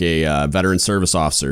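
ordinary delivery

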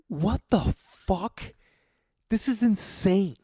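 slow, high-pitched incredulity; appalled disbelief. Retold or imitated. Bartender finding cigarettes in leftover wine, appalled disbelief. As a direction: draw it out, aghast and high. Retold shock at littered bar.

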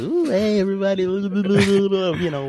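put-on high, loud sloppy drunk greeting; drunk cousin. Cousin arriving drunk at a baby's party, high and loud. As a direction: play the shit-faced cousin working the room, high and loud. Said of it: "you know, just".

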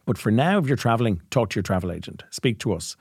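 ordinary delivery

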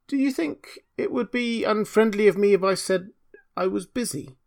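high, timid question; shy and timid. A narrator's reading. Hans daring to ask the miller's leave, shy and timid. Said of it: "in a shy and timid voice".

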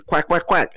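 silly duck noises; mock duck quacks. Talking to duck entity.

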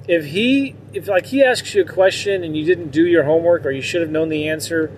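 normal talking voice

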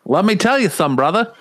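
high, hammy Australian wrestler promo; badly faked Australian catchphrase, pitched right up; Australian-accented Hulk Hogan. Acting out the imagined biopic speech, terrible Australian accent. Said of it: "he's doing it in an Australian accent".

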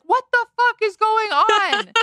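high-pitched drawn-out mock yell; mock outburst yell. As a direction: imitate someone yelling out of nowhere, slowly. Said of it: "just yelling out of nowhere".

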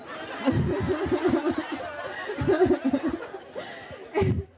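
long, high laugh; big laugh. Real, not acted. Storyteller after quoting her boast, high laughing fit. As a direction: crack up at your own boast.

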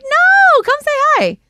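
high, loud eager "no, come say hi"; eager invitation. Retold or imitated. Telling shy fans to greet her, high and eager. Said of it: "I'm like".

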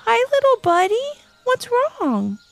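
gentle voice. A narrator's reading. Levi comforting a lost bunny, gentle. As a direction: coax a scared little one. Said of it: "in a gentle voice".

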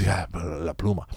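low, soft, slow reply; imitated booth lady. Car wash lady confirming the Spanish word, low slow imitation. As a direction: lightly imitate her, hushed and drawn out. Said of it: "And she says".

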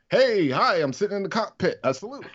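high, quick throwaway pilot line; lightly voiced dismissive pilot; mocking bit part. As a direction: belittle the cameo. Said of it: "What did Wedge do?".

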